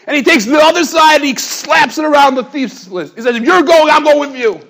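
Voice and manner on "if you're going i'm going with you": determined. Retold or imitated. Cop cuffs himself to thief.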